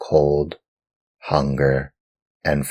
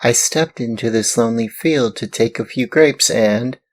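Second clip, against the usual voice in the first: put-on high, eager little boy voice, a bit louder; puppet boy explaining; 8 semitones higher, 4 dB louder than usual. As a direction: rush the excuse like a small boy.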